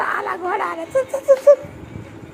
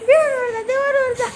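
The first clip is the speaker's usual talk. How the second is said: high-pitched, drawn call; excited urging; 12 semitones higher than usual. Real, not acted. Urging child to climb up.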